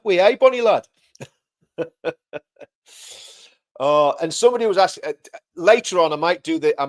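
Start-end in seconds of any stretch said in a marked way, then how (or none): 0.0-0.9 a hammed-up Geordie greeting, higher and louder; Geordie lingo. Teaching the host to talk like a Geordie, mock Geordie greeting. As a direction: toss it off like a cheery Geordie. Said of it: "You gotta talk like that".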